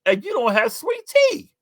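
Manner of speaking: high, slow incredulous mock protest; retold outrage. Scolding Burger King staff, slow high outrage. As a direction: drag it out in disbelief. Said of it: "I said".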